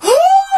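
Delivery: very high-pitched excited squeal; mock fan scream. Little girl spots a TikTok creator, mock screaming. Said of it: "Ela começou a gritar".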